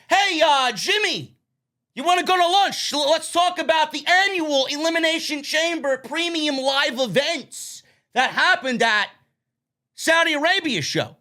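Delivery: high, stilted corporate voice; mock exec. Mocking the WWE exec who talks corporate jargon, high and stilted. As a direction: play a stiff executive inviting Jimmy to lunch. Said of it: "Who talks like that?".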